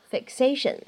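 "fixation" with an unreleased k sound; incomplete plosion. Shown as a demonstration. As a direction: hold the k in "fixation", don't let it pop. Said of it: "存在一个不完全爆破".

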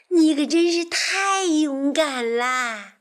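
high, loud, slow exclamation; admiring praise. Acted. Frog praising the friend who saved him, excited admiration. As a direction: stretch out the admiration.

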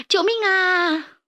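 high, loud, slow panicked wail; cry for help. Acted. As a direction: draw out the scream for help. Kids fleeing the rats.

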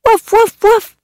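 high loud happy woofs; puppy barking. Acted. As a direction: bark like an excited puppy. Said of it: "said Sam".

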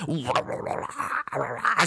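soft garbled chatter of many voices; low mumbling din; frog-like babble. Retold or imitated. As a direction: mimic a crowd croaking like frogs. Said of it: "像蛤蟆吵瘟一样".

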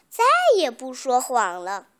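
plain reading voice

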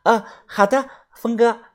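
put-on high, sweet girlish call; sweetly. A narrator's reading. Girl agrees to the new nickname, sweet high voice. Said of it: "他甜甜的叫了我一声".

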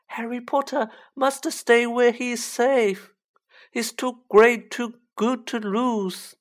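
high, urgent elf voice; devoted little creature pleading at high pitch; Dobby, pleading house-elf. Acted. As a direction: warn him high and emphatic.

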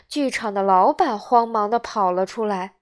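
plain reading voice

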